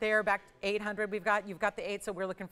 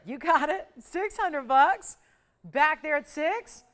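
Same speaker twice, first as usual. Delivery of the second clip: loud, high and stretched bid call; excited bid call; 6 semitones higher, 8 dB louder, slower than usual. Acted. Host calling out the six hundred bid, loud and excited. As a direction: cheer the bidder on at six hundred.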